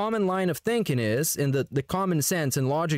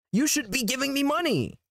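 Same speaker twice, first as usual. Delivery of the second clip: high, whiny entitled voice; mocking whine; 9 semitones higher than usual. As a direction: play a spoiled streamer demanding money. Imitating streamer's entitlement.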